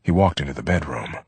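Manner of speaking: ordinary delivery, nothing marked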